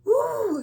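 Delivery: put-on high, excited child's gasp and ooh; toddler's delighted gasp. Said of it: "he's like".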